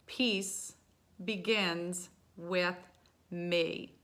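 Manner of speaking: slow, spaced-out mantra; measured mantra with gaps between words. Shown as a demonstration. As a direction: recite it slowly, pausing between the words. Reciting a mantra to begin practice.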